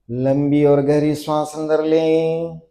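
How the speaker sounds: long-drawn breath cue, then loud, insistent commands; forceful yoga cueing. Acted. Yoga teacher starting the last round with students, forceful drill cueing. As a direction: push the class to tighten up hard.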